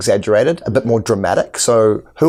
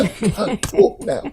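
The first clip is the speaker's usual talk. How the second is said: squeezed, strained voice on empty lungs, much higher and slow; out of breath; 13 semitones higher than usual. Shown as a demonstration. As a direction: talk with no air left in the lungs. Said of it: "empty the breath out of my lungs".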